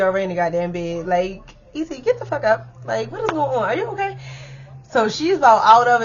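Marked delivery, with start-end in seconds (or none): 1.7-4.2 high, pressing voice shaking her awake; urgent wake-up call. Retold or imitated. Waking a friend off the floor, urgent high call. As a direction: rouse her, then worried.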